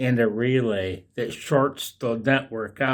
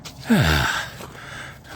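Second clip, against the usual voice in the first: long breathy sigh after a run; real out-of-breath sigh; winded sigh; 7 semitones lower than usual. Real, not acted. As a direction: let out a long, puffed-out exhale. Winded after running with dog.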